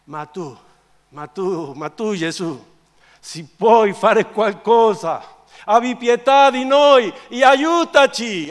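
plea building to a loud high cry; desperate father. Acted. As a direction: beg slowly, rising into desperation. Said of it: "il cuore di un padre disperato".

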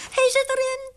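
put-on very high, loud child's cry; excited little girl. A narrator's reading. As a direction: call out the catch as a small daughter. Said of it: "riep ze".

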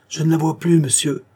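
high, alarmed cry; terrified. A narrator's reading. Coachman to his master in the dark storm, high terrified voice. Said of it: "d'une voix pleine de terreur".

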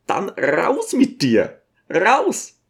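high, drawn-out command to get out; ordering him out. Acted. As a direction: throw him out without a full shout. Throws the teacher out. (gedehnter, hoher Rauswurf)

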